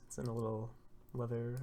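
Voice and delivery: plain reading voice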